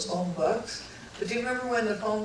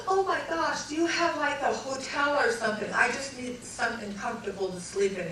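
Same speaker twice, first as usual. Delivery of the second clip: pleading wife; 5 semitones higher than usual. Retold or imitated. Tired wife begging farmer.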